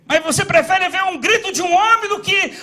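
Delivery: loud, climbing pulpit preaching; fervent sermon shout; preaching at full force. Acted. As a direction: preach it out to the congregation.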